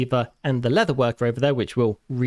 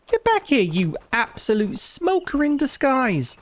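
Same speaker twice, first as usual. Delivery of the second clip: indignant call; 10 semitones higher than usual. Real, not acted. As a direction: yell after the thief. Chasing the thief villager.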